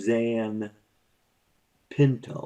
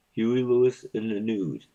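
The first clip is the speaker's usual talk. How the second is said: usual speaking voice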